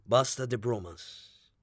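dry irony pitched low; ironic retort. A narrator's reading. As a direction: taunt him quietly, in a low measured voice. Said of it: "El inglés replicó irónico".

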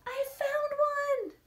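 high, slow, thrilled announcement; excited. Acted. Child telling mom she found a salamander, thrilled. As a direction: burst with the joy of discovery.

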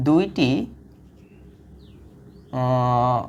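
reading the task out word by word, slowly. Shown as a demonstration. Teacher slowly reading the exercise to students. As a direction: spell out the problem very slowly for the class. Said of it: "এখন তোমাকে বলছে".

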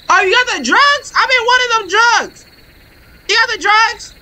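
high needy addict voice. Pretend. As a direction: whine high like a desperate addict.

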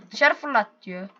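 ordinary talk

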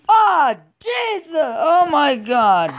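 loud, high, slow shriek of fright; a loud high drawn-out scared cry; startled scream. Real, not acted. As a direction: yelp it as if jump-scared. Startled by something scary in game.

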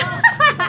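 high laugh. Real, not acted. Laughs after call ends.

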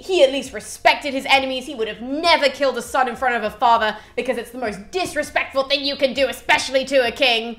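loud furious yell at his son's killer; angry shout. Retold or imitated. Priam shouting at Pyrrhus over his son's murder, furious yell. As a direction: shout him down with outrage. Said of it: "he screams at Pyrrhus".

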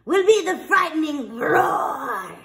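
growling headmaster. Acted. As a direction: growl it out like a fierce teacher. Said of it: "growled the headmaster".

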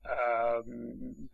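usual speaking voice

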